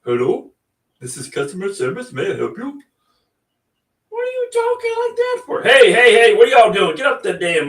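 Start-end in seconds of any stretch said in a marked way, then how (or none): 4.1-5.6 very high squeaky chicken voice, mocking. Chicken mocks the proper greeting. 5.6-7.7 loud calls to shoo the chickens; shouting. Real, not acted. Chasing chickens off the mic.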